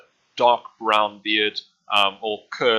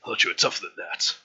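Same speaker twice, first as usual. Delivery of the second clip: butcher voice. Acted. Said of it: "he's freaking Adam Jensen".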